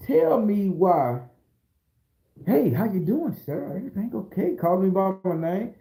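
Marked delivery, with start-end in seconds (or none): 2.5-4.6 lightly voiced, pitched-up courtesy; a hint of sweet official politeness; mock-polite official. Court official greeting him months later, lightly acted politeness.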